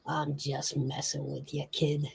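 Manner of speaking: quiet, easy tough-guy reassurance; backing-off mobster. Acted. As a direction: drop the threat quietly, as if joking.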